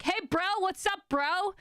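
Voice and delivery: high-pitched bro otter voice; northern otter bro. Acted. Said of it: "that's their accent. The northern otter is like".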